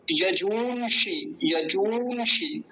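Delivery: यजूंषि slowly, with a long ऊ; यजूंषि with a long ऊ and a retroflex ष; careful pronunciation. Shown as a demonstration. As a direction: stretch the ऊ in यजूंषि. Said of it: "दीर्घ ऊकार है".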